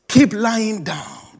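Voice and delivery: slow, drawn-out. Shown as a demonstration. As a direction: draw out keep lying down slowly and firmly. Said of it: "He makes me to lie down".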